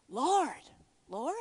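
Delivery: tentative, questioning Lord. Retold or imitated. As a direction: Lord said first as a call, then lifted as a question. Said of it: "he said it like a question".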